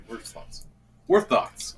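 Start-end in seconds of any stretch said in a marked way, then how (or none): 0.1-0.7 breathy stage whisper. Pretend. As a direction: whisper it like a secret. Whispered then said aloud.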